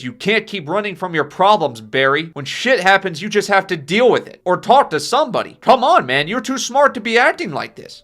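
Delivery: high, loud pleading scold. Retold or imitated. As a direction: scold your son and beg him. Father scolding drunk son.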